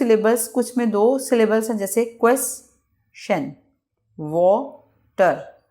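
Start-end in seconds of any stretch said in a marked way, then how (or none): 2.0-3.5 question split in two, ques, pause, chen; teacher parting question into two beats; broken into syllables. Shown as a demonstration. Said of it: "दो parts में हम अदायगी करते हैं". 4.2-5.6 water slowly split as wa, pause, ter; two separate beats on water; broken into syllables. Shown as a demonstration. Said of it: "दो parts में हम अदायगी करते हैं".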